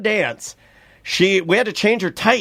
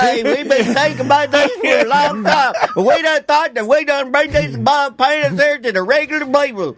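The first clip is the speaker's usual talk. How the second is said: hillbilly character; 7 semitones higher than usual. Pretend. Pitching investors in overalls, mock hillbilly accent. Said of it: "have to play some sort of character".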